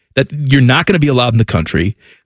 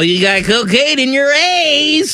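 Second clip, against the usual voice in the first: slow, high hammed-up officer yell; high slow mock shout; mock customs officer shout; 11 semitones higher, slower than usual. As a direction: bust him like a TV customs cop. Acting out TV customs bust.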